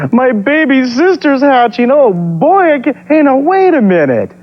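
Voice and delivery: excited little dinosaur. Acted. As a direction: gush like an eager big brother dino. Eek voiced about new baby sister.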